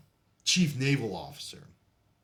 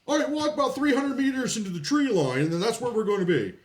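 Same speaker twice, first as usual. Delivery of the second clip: barking sergeant order; 11 semitones higher, 5 dB louder than usual. Retold or imitated.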